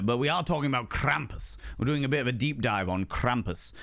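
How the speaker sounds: ordinary delivery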